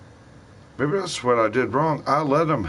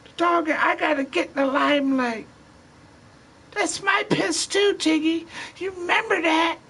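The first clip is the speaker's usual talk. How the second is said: squeaky high mimic of a buddy, much higher; high put-on voice. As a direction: pitch way up and imitate him. Friend quoted in put-on voice.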